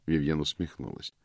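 usual speaking voice

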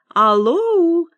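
high, loud telephone hello; woman on phone. A narrator's reading. Woman picking up the phone mid-wait, high and loud. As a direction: pitch it up, loud drawn hello. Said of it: "Кажется, женщина, подошедшая к аппарату".

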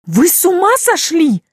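high, loud outraged scream. Acted. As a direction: scream it over the water. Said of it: "Мой крик потонул в шуме воды".

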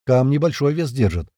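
usual speaking voice

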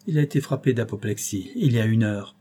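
usual speaking voice

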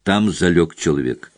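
ordinary delivery

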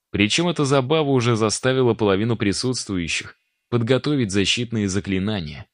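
plain reading voice

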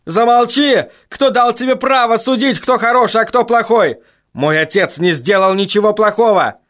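high furious yelling; furious shout. Acted. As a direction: scream at him to shut up. Brandon screams at his father's killer.

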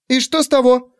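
put-on high, defiant young girl. A narrator's reading. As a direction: snap back like a bratty sister. Sister retorts defiantly.

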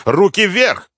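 loud, high-pitched police order; loud command. A narrator's reading. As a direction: command it sharply at gunpoint. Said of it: "громко скомандовала".

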